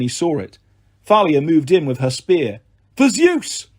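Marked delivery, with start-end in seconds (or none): 3.0-3.7 high-pitched charging cry; battle cry. A narrator's reading. Thalia attacks with her spear, battle cry.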